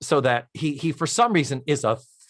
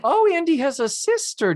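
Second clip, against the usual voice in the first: much higher, mock-innocent sing-song; sarcastic suspicion; 3 dB louder than usual. Tootie hearing Andy's sister excuse, mock-innocent sarcasm. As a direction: pretend to believe it while clearly doubting. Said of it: "but it's like".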